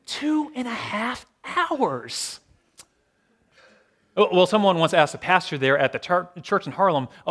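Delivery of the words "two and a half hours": very slow, high incredulous repeat; drawn-out amazement. Real, not acted. Preacher marvelling at a very long service, drawn out. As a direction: stretch every word, lifted high.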